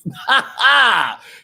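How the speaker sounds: high, loud cackle; loud delighted cackle; shrieking laugh. Real, not acted. Laughing at the boyfriend.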